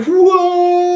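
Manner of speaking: high, loud whoa as if leaping. Acted. Pretending to leap the candlestick, high loud whoa. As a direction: yelp mid-jump.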